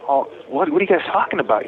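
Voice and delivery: high-pitched, mildly baffled protest; retold disbelief. Suspect asks police what they're talking about, mock baffled. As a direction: quote yourself questioning them in disbelief.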